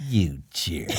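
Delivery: low mock-disgusted retort; father-in-law. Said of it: "my father-in-law looks over and he goes".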